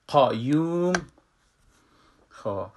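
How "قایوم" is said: قایوم with a thick rounded qa; Arabic heavy qaf. Shown as a demonstration.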